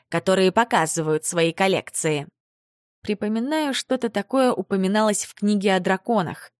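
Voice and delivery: ordinary delivery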